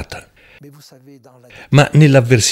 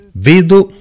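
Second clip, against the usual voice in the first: high, loud cry of Vedo; exclaimed vision; 9 semitones higher, 5 dB louder than usual. Acted. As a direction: burst out with Vedo as if seeing a spirit. Quoting the medium's vision.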